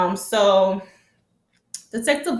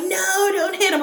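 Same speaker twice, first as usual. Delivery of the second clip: high imitated plea to stop; mock pleading; 10 semitones higher than usual. Crew begging Vincent not to hit Tariq, high and pleading. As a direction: beg them to stop, put on high.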